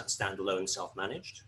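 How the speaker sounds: normal talking voice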